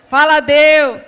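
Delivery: loud rallying shout. Real, not acted. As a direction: rouse the audience to speak to God. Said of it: "cheering the women on".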